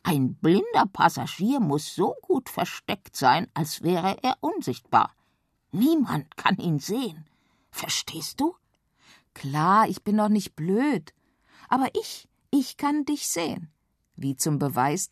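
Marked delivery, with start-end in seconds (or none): 0.0-7.3 frog voice. A narrator's reading. Frog explains. 7.7-8.6 soft unvoiced whisper. A narrator's reading. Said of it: "wisperte bedeutungsvoll". 9.3-13.7 put-on cheeky girl. A narrator's reading. Feli retorts.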